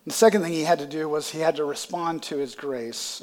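ordinary talk, nothing marked

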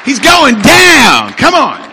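high, roaring revival shout; rally the congregation at full volume; exultant preacher's battle cry; full-force preaching. Real, not acted.

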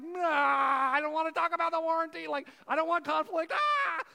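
high, loud, flustered protest against any conflict; panicked conflict-avoider. Retold or imitated. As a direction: panic rising into a final yelp of "Ah!". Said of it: "and I'm like".

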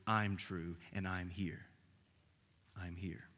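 quiet voice of comfort; tender reassurance. Acted. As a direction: soothe the anxious listener gently. Voicing Jesus comforting listeners.

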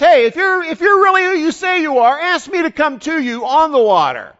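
high, loud call across the water; challenging shout. Acted. Peter calls to the ghost on the water, challenging shout.

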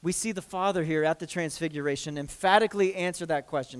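usual speaking voice

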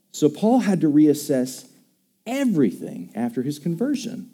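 ordinary delivery

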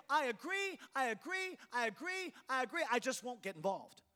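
high, repeated mock agreement; insincere, high-voiced assent; disingenuous agreement. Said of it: "How disingenuous is it for us to say".

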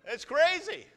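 loud, high comic protest; mock complaint thrown out big to the crowd; excited joking. Pastor joking to the church, loud and high. Pastor jokes loudly about God's plan.

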